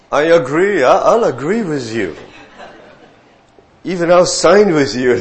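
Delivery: high, loud mock-eager yes-man; hammed-up keen co-signer; eager agreement. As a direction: ham up the eager elder who signs anything.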